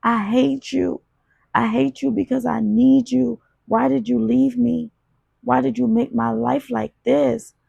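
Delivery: hysterical. A narrator's reading. Daughter at mother's grave, high hysterical grief. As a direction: pour out rage at the dead mother. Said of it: "I said in a hysterical slur".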